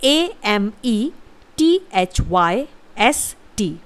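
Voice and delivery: letters spelled out slowly; slow spelling. Shown as a demonstration. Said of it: "इसकी spelling होती है". (धीरे-धीरे spelling)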